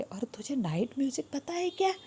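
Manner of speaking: whispered "और तुझे night music पता है क्या?" with no voice. Shown as a demonstration. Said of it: "या शायद डोंकी पूछ रहे". ("तुझे नाइट म्यूजिक पता है क्या" फुसफुसाकर)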